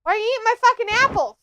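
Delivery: quick, high, irritated complaint; fast annoyed rant. Real, not acted. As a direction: snap at them for stealing apples. Scolding someone eating apples.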